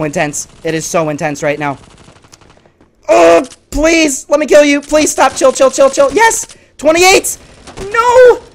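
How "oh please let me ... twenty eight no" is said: high, frantic pleading and cheering; loud, desperate gamer outburst; worked-up yelling. Real, not acted. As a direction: shriek as the match swings. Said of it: "I'm really worked up on this".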